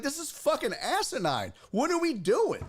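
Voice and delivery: high-pitched exasperated retold rant; worked up, voice pushed high; getting mad. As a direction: whine in angry disbelief. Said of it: "I was getting mad".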